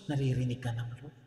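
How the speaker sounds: low, soft and hushed; hushed reply. Acted. Pastor telling the doubting listener the Lord hears, low and hushed. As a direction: drop the voice low, a gentle aside. Answering each thought, hushed.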